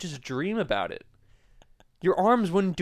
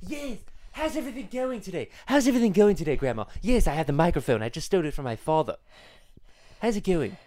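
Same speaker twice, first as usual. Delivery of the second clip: Stewie impression; 2 semitones higher than usual. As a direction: talk like a scheming cartoon baby. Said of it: "I brought my friend Stewie here".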